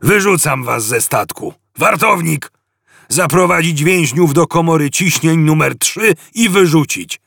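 pompous captain giving cold orders; a bored alien commander dismissing prisoners; Vogon captain. A narrator's reading. Captain condemning prisoners, cold pompous orders.

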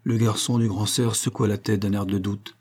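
ordinary delivery, nothing marked

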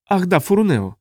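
high alien character voice; alien Tuluk recalling. Acted. Tuluk remembers a colleague's name, high alien voice.

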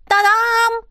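high magician's fanfare; showy ta-dam. Acted. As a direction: present it like a trick. Mother shows ring like magician. (мама эффектно: «Та-дам!»)

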